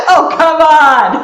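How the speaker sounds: loud, high laughing protest. Real, not acted. Host teasing guest who refused the accent, loud laughing protest. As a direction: burst out laughing through a drawn-out plea.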